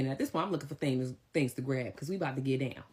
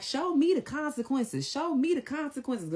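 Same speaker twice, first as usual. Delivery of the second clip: high, louder, slow dare; daring challenge; 12 semitones higher, 4 dB louder than usual. Retold or imitated. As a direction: spell it out slowly, daring him. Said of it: "And she was like".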